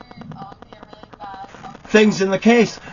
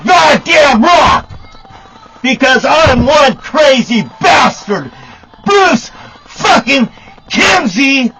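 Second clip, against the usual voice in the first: slow, much higher and louder raging yell; furious shout; 9 semitones higher, 7 dB louder than usual. Real, not acted. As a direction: scream the threat at full force. Threatening the sheriff, shouting.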